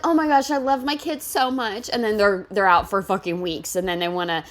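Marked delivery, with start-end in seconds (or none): 0.0-1.9 lightly voiced gushing mom, a bit higher; sarcastic impression of a neglectful mom; mocking fake mom. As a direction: parody a fake-loving mother.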